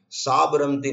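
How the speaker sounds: साबरमती said slowly as साब-रमती; wrong syllable break. Shown as a demonstration. Confused listener wondering about the river, misdividing साबरमती as साब-रमती. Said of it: "जब आप शब्द को syllable के नियम से नहीं तोड़ते हैं तो बड़ी गड़बड़".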